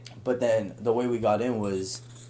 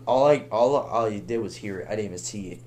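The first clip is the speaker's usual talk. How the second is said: usual speaking voice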